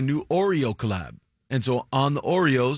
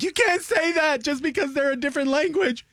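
mock outraged internet. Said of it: "everyone on the internet was like".

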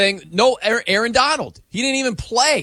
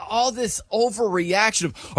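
usual speaking voice

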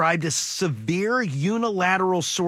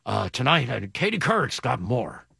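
lower, mumbled anchorman delivery; mock newsman thanks and hand-off, pitched down; Tom Brokaw; 6 semitones lower than usual. Host as anchor thanking a helper on stage, Brokaw voice. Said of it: "hot potato voice is my Tom Brokaw".

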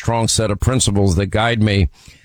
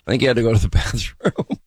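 breaking into laughter mid-sentence; laughter swallowing the end of the line; laughing; 2 semitones lower, much faster than usual. Real, not acted. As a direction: crack up before the last word. Host cracks up at bathroom joke.

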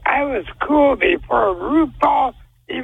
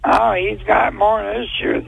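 ordinary delivery

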